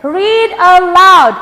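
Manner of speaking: slow, clear "Read aloud."; slow model reading. Shown as a demonstration. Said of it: "ada yang pernah dengar kata read aloud?". (ucapkan "Read aloud." pelan dan jelas)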